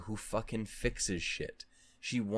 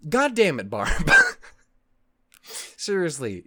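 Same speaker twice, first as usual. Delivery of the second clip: high, loud gushing praise; exclaiming in awe; excited outburst; 5 semitones higher, 8 dB louder than usual. Real, not acted. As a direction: burst out in delighted exasperation.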